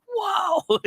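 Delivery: high, stretched retold whoa; lightly imitated surprise; mock amazed whoa. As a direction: onlookers stunned by a throw. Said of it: "they're like".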